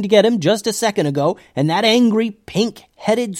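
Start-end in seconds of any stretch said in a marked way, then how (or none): none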